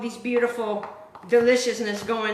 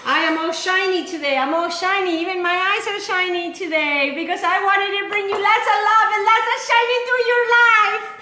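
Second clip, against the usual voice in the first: gushing cheer; 7 semitones higher, 4 dB louder than usual. Real, not acted. Bubbly host greeting viewers.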